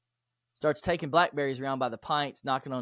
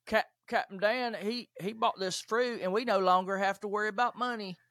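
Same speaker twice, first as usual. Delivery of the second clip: Forrest Gump impression; 8 semitones higher than usual. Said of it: "my best Forrest Gump impression".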